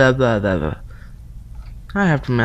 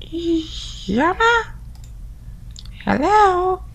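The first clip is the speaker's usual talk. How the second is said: high put-on girly voice; girl voice; 11 semitones higher, 8 dB quieter, much slower than usual. Yama's friend calling through the door in a high girl voice. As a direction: pitch way up into a girl's voice. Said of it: "I'm sorry about my girl voice".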